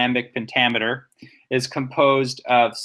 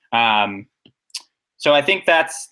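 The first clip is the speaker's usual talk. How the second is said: ordinary talk, nothing marked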